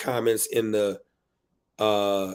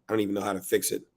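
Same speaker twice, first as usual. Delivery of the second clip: usual speaking voice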